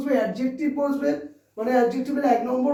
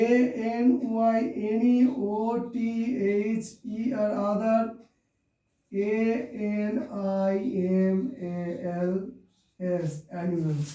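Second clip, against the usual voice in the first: slow, low, softer letter-by-letter spelling; dictating while writing; 4 semitones lower, 5 dB quieter, slower than usual. Real, not acted. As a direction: murmur each letter with long gaps, pacing the pen. (লিখতে লিখতে নিচু গলায় ধীরে বানান)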